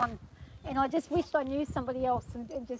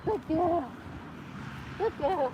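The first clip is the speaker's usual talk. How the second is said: bright relieved praise; 5 semitones higher, slower than usual. Real, not acted. Relieved praise after dismount.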